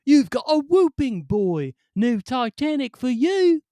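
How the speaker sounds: high, loud official yelling; shouting British official. Acted. Ramp official shouting the boy off the Titanic, high and loud. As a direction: yell it like an outraged port officer. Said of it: "which roughly translates to".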